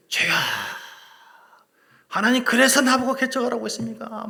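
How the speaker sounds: loud, high exasperated cry; hammed-up yell of complaint; shouting at God. Pastor crying out to God about planting the church, loud. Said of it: "저도 소리 질러요".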